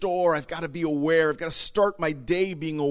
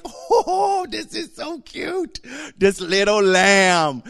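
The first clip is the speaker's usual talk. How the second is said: put-on high, delighted child voice cooing over a lamb; kids cooing over a lamb; 7 semitones higher, 5 dB louder, much slower than usual. Kids squealing over the little lamb in the house, high and delighted. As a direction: squeal it like an excited kid seeing a lamb. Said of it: "They'd be like".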